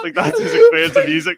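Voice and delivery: high and loud, laughing through the words. Real, not acted. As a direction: speak mid-laugh, amused. Still laughing after the joke.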